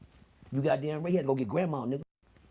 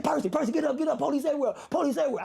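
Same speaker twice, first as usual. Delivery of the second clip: higher, urgent alarm; panicked warning; 9 semitones higher than usual. Retold or imitated. Little brother warning Percy of the raid, high and urgent. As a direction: wake him up in a hurry, high.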